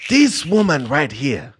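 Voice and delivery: a light Wakandan king voice. Acted.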